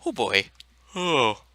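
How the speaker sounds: words stretched through a yawn. Real, not acted. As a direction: say it mid-yawn. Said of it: "sorry about that yawn".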